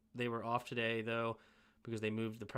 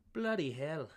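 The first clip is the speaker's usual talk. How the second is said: a bad put-on British accent, mock horror; amateur British accent, self-mocking; terrible British accent; 2 semitones higher than usual. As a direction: try a clumsy British accent on it. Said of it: "That was a terrible, terrible British accent".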